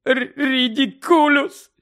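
high, loud sobbing cry, stammered; sobbing spell. Acted. Mother casting the spell through sobs, high and slow. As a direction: choke out the spell through tears. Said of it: "ридаючи".

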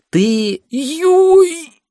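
high loud yelp; pained yelp. Real, not acted. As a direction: yelp in sudden pain. Fома kneed, yelps.

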